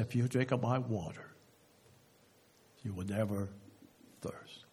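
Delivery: low voice fading almost to nothing; hushed awe. Retold or imitated. Preacher retelling Jesus' promise at the well, hushed.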